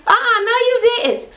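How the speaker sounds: high-pitched mock outrage; outraged disbelief. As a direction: act out an affronted "oh no you didn't". Acted reaction to thrown drink.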